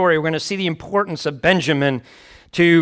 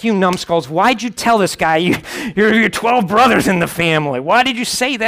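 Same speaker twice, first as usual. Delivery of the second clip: exasperated dad scolding; 4 semitones higher than usual. Retold or imitated. Dad scolding his sons.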